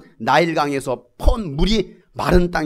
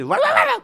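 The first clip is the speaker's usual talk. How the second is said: high, quick burst of jabber; rapid-fire mock yapping. Mouthing off in a fight, high yapping. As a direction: mimic a tongue running loose in a fight. Mimics loose tongue in a fight.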